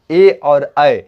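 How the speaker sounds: ए and ऐ said out loud; sound demo. Shown as a demonstration. Teacher sounds the vowels ए and ऐ. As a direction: sound ए then ऐ clearly. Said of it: "ए ऐ का उच्चारण".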